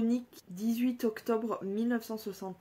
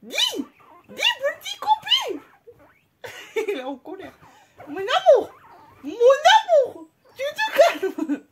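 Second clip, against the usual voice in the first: much higher, louder squeal through laughter; laughing high squeals; 14 semitones higher, 11 dB louder than usual. Real, not acted. Owner laughing at her angry pet, squealing. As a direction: laugh while scolding the pet.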